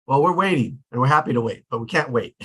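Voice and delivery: ordinary talk, nothing marked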